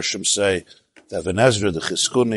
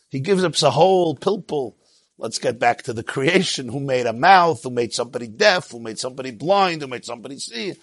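usual speaking voice

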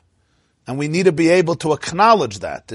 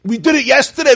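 much higher and louder, fed up; exasperated outburst; 11 semitones higher, 7 dB louder than usual. Shown as a demonstration. Rabbi mock-shouting at the looping inner chatter, fed up. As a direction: burst out with it, fed up.

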